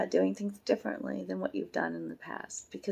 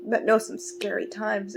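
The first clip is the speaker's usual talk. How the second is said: usual speaking voice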